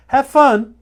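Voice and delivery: bright burst. Acted. Sudden cheerful aside in warning.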